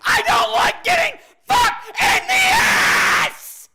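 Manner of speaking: high, very loud furious shrieks; enraged screaming. Real, not acted. Gamer begging the game to stop, enraged screaming. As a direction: scream every word at full force.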